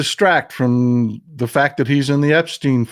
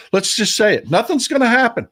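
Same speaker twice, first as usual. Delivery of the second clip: high-pitched, bitter exasperation; exasperated; 9 semitones higher than usual. Real, not acted. Host admitting the legislature will do nothing, exasperated. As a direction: throw up a blunt, high-pitched verdict. Bitter verdict on political inaction.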